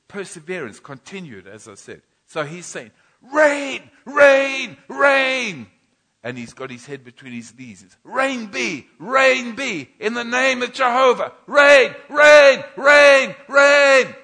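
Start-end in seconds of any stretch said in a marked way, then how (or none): 3.2-14.2 high and very loud, crying out for rain; passionate prayer shout. Retold or imitated. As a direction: pour the prayer out with force. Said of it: "doing it with passion and violence".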